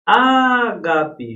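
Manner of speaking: "Ágape" with a long, loud first Á; high, loud "ÁÁÁ-gape"; stress on antepenultimate syllable. Shown as a demonstration. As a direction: hold the opening vowel of "Ágape". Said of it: "A sílaba tônica é a antepenúltima".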